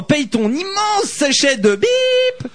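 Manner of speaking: mock shout. Reading a listener's message aloud.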